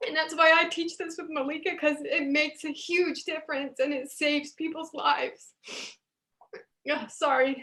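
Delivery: holding back tears while explaining why she teaches; tearful. Real, not acted.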